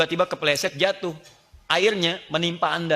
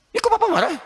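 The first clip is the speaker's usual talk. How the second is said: much higher, louder wife's whine; startled wife; 5 dB louder than usual. Acted. Wife squeals at her angry husband in a high, loud voice.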